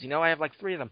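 usual speaking voice

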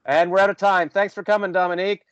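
high, mock show-closing voice; mock sign-off. Joke sign-off right after intro.

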